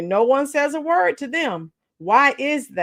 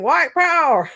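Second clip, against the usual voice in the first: Mister Bixby impression; 6 semitones higher, 4 dB louder than usual.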